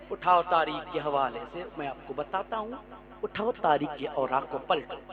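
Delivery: low, very soft opening of the tale; hushed storytelling. Acted. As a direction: draw listeners in quietly. Calm storytelling start. (धीमी, नरम क़िस्सागोई)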